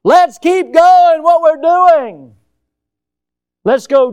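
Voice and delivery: high, loud, slow mock rallying call; preacher mocks society's call to keep going; mocking the crowd's cry. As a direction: parody the cheerful call to press on. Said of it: "Forward is the cry today".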